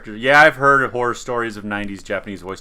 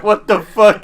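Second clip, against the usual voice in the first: incredulous, high and loud; baffled outburst; 8 semitones higher, 8 dB louder, slower than usual. Retold or imitated. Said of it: "and I'm like".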